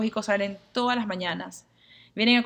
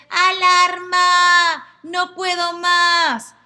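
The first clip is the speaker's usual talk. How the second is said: slow, drawn-out high shout of alarm; 7 semitones higher, 8 dB louder, much slower than usual. Acted. The drowning inner self cries out for help, shouting slowly. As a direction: yell the alarm, stretched out. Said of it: "mi yo esencial dice".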